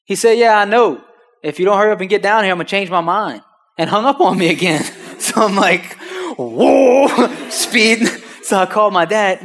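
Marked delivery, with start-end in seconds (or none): 0.4-3.4 impatient seller. Retold or imitated. Seller hurries the nervous buyer. 6.4-8.1 loud, high "whoa" of amazement; astonished shout. Retold or imitated. Reacting to the sudden deal.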